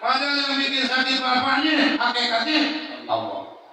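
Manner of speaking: high, loud preacher's punchline; fiery sermon climax; preaching at full force. Acted. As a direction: drive it home to the congregation.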